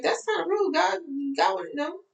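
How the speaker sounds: high, hammed-up offended voice; mock offended follower. Follower scoffing at the speaker, high mock-offended voice. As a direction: play the doubter scoffing at her. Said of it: "some of you might be like".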